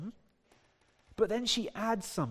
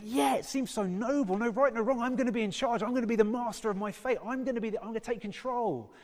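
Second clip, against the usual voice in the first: higher-pitched, eager declarations; inspired listener; 5 semitones higher, faster than usual. Acted. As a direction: build a run of keen resolutions, acted out. Acted listener inspired by the song.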